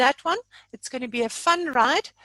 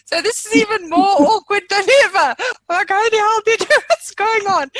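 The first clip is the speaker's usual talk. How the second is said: laughing embarrassment; 7 semitones higher, 9 dB louder than usual. Real, not acted. Flustered laughing about awkward rename.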